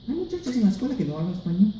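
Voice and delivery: put-on high, rapid-fire kid's report; child to dad. Schoolkid reporting to dad at home, high and hurried. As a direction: rattle it off high like an anxious schoolkid. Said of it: "I went home and I said".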